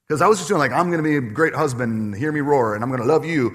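loud, hammed-up proud husband boast; a playful mock roar of a proud husband; mock macho roar. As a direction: puff up and boast like a lion. Joking about grand husband bravado.

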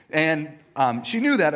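normal talking voice

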